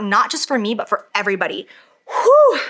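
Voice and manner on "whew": loud high breath out to calm down; relieved whoosh; big exhale. Real, not acted. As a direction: blow the tension out. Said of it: "Let me breathe".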